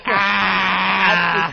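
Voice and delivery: loud mock maniacal laugh; obnoxious cackle. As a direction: blare out the clown's laugh. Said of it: "you just had that".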